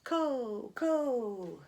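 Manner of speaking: hollow, high echo; eerie echo. Acted. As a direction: echo back from the bottom of the well. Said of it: "And it goes".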